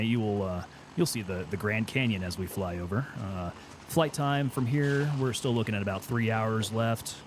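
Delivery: calm captain over the intercom; pilot cockpit voice. Shown as a demonstration. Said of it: "You kinda have a pilot's voice".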